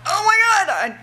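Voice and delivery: high thrilled shriek; excited squeal. Retold or imitated. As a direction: squeal with joy. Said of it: "I was like".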